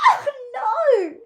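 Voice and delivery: high, loud laughing cry; high, loud laughing exclamation. A narrator's reading. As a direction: crack up at the mistake. Phoebe fails and laughs.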